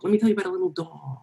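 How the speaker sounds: playful W C Fields accent impression. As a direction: toss off the line in a W C Fields accent. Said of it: "he slid into his W C Fields accent".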